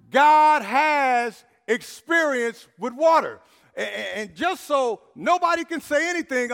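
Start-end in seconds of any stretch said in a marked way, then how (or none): 0.1-3.4 slow, loud, every word weighted; emphatic repetition. Shown as a demonstration. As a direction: proclaim it slowly to one side of the church. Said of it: "I'm gonna say it on this side".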